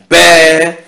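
solemn, projected prayer; loud prayer to the Father. Acted. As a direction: call up to heaven, then slow down. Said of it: "leva les yeux en haut et dit".

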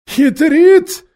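loud, very high character voice; suspicious aside. A narrator's reading. Khmur doubting the prisoner, high suspicious voice. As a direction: throw it out high as a suspicious question. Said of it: "пробормотал Хмур".

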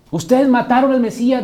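acusación de predicador, fuerte y aguda; señala culpables con voz de denuncia; Pedro. Acted. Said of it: "dijo Pedro en la primera predicación". (denuncia pública proclamada)

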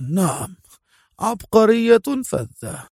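high, slow mumbled agreement; turned-away mumble. A narrator's reading. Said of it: "غمغم موريس وهو يشيح بوجهه بعيدا".

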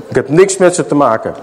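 normal talking voice

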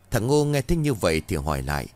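usual speaking voice

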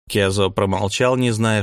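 ordinary delivery, nothing marked

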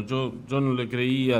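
ordinary talk

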